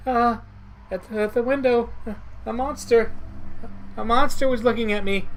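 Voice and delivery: scared stammer. Acted. Girl tells the detective about the monster, frightened and stammering. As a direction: blurt it out, spooked by the window. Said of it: "Naneko was shaking all over and seems to be scared".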